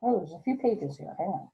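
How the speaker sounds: ordinary delivery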